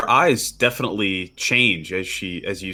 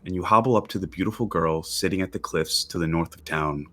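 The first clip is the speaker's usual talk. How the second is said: normal talking voice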